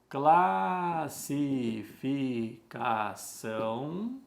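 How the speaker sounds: very slow, syllable by syllable with long pauses; slow dictation. Shown as a demonstration. Teacher writing the heading for the class, slow dictation. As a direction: stretch each word as if writing it out.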